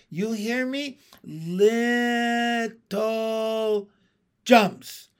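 high, loud, drawn-out "little"; exasperated, stretched-out command; emphatic instruction. A narrator's reading. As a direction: spell it out as if to someone slow. Instructing a slow-witted frog.

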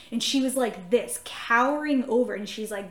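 ordinary delivery

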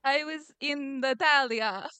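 slow, badly faked Italian accent. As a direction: attempt an Italian accent and botch it. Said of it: "I can't do an Italian accent".

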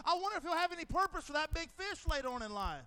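mock tourist gawking; playful wonder. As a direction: point out the whale with delight. Playful aquarium sightseeing.

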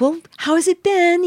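high-pitched mock interviewer; imitating an interview question. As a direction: ask it high, as a put-on quote. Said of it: "they just asked".

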